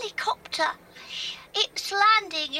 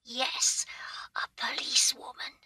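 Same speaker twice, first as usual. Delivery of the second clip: hushed whisper, hiding under the bed; whispering while hiding; 5 semitones lower, 6 dB quieter than usual. Acted. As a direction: whisper so nobody finds you. Child hiding from police, whispering.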